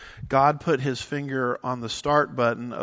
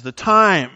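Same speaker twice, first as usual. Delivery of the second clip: "time" punched much higher and louder; stress on "time"; 6 semitones higher, 11 dB louder than usual. Retold or imitated. Preacher quoting Jesus' warning, heavy stress on "time". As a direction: hit "time" with a big, high burst. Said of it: "make an emphasis on time".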